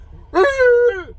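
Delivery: short high burst of laughter. Real, not acted. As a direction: laugh high at your own joke. Laughs at own point.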